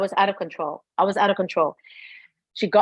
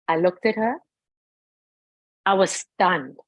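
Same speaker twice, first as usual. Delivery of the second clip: ordinary talk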